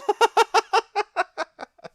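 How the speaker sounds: big high laugh bursting out mid-take; cracking up while recording a line; burst of laughter. Real, not acted. A big high laugh breaking up the voiceover take.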